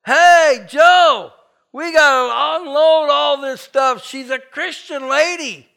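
high, loud call out the door; burglar yelling. Retold or imitated. Burglar shouting to his partner in the pickup truck, loud and high. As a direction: bellow to a partner outside, slowly. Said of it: "He yells".

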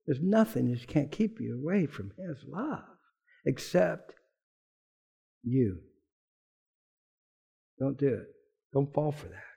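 low and soft, gentle pleading; quiet earnest warning; tender plea. Real, not acted. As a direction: plead softly with the congregation.

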